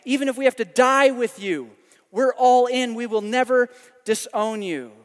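overconfident, forceful protest; emphatic agreement. Acted. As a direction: vow it boldly as one of the band. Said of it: "they all agree. They're like".

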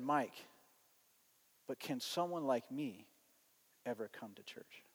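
very soft, low and halting; hushed, vulnerable question. Retold or imitated. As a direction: hesitantly ask whether you are welcome. Grieving outsider asks if he is welcome.

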